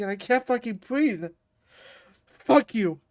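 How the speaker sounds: ordinary talk, nothing marked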